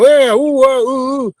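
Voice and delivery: high, hammed-up squeals of panic; mock freak-out. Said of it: "all the freak out moment".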